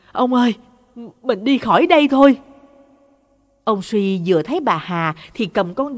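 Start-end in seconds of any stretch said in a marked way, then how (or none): 0.1-2.4 raised, anxious plea; urgent calling. A narrator's reading. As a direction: call out pleadingly, higher and hurried at the end. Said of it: "gọi". (gọi gấp gáp, van nài)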